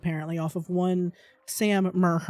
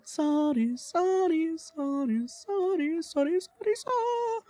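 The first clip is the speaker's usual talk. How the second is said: mock sobbing apology; 10 semitones higher, slower than usual. Apologising more and more dramatically.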